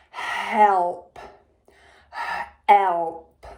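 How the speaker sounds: "Help" sounded out slowly, each sound drawn out. Shown as a demonstration. Teacher to pupils, sounding out "Help" sound by sound. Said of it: "use our sounds".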